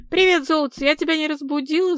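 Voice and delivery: high, loud, lively chatter. A narrator's reading. Людмила phoning a man she may have woken, chattering. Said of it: "затрещала Людмила".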